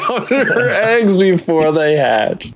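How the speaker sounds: slow, high, laughing echo of a pun; laughing repeat. Real, not acted. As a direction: savour the pun through laughter. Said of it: "this is why our channel works".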